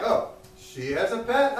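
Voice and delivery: usual speaking voice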